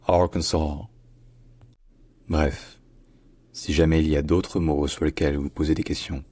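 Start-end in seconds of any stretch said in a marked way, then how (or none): 0.0-0.9 "Arkansas" as AR-kun-saw, stress on AR, no final s; "Arkansas" ending on an open saw; American Arkansas. Shown as a demonstration. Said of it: "Arkansas en anglais américain".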